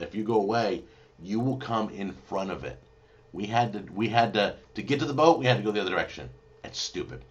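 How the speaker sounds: usual speaking voice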